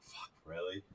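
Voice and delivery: low, very quiet mutter; muted exasperation. Retold or imitated. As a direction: grumble it under your breath. Exasperated reaction kept to himself.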